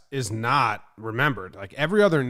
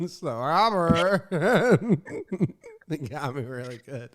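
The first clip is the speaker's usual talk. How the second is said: laughing; 4 semitones higher than usual. Real, not acted. Laughing recalling the flub.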